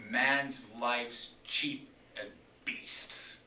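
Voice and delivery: slow bitter whisper. Acted. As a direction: drop it to a slow hiss of contempt. Whispered within Lear speech.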